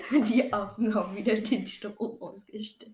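laughing through the punchline again; amused laughing repeat. Real, not acted. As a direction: giggle as you repeat the joke.

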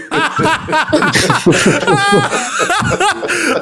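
bursting out in high laughter. Real, not acted. Friend cracks up at joke.

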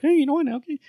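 giving up. Retold or imitated. Host giving up on the comic's logic, high mock-cheery resignation. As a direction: fake breezy surrender. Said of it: "I was so like".

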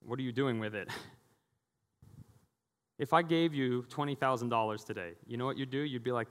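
ordinary delivery

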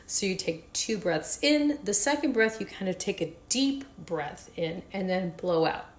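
ordinary talk, nothing marked

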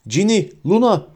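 high-pitched calling out; shouted names. A narrator's reading. Harry turning to call his friends, shouting. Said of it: "diye bağırdı Harry".

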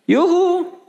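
high, gleeful yoohoo; mock cheer. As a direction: cheer like a delighted kid.

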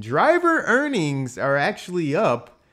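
slow, high reveal of news; emphatic reveal. Real, not acted. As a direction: land the surprising number. Revealing surprising stat.

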